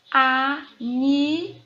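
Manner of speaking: animal slowly as a, ni, mal; loud, stretched, separated syllables in animal. Shown as a demonstration. Teacher asking for the tonic syllable, animal split syllable by syllable. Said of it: "Qual é a sílaba tônica?".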